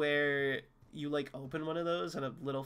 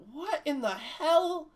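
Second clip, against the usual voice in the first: bewildered; 11 semitones higher, slower than usual. Real, not acted. Reacting to weird room.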